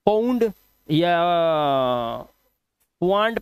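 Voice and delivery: फाउंड misread with guessed vowels, "pond", then "waand". Shown as a demonstration. Teacher mimics an unschooled reader guessing at the word, misreading. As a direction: sound out FOUND wrongly as "pound" or "waand". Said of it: "कोई अनपढ़ आदमी क्या ही करेगा".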